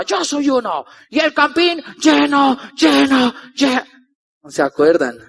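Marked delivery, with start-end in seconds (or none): 0.0-3.8 a stadium chant echoed in a mild mock yell; imitating the crowd's shout. Speaker imitating the stadium crowd, mock chanting shout. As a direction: chant it like the packed crowd, half-hearted. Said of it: "Y la gente gritaba".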